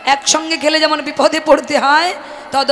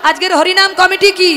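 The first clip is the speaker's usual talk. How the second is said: high, loud call for the crowd to answer; loud call and response lead; rousing call; 5 semitones higher, 5 dB louder than usual. Real, not acted. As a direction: cry it out and wait for jay.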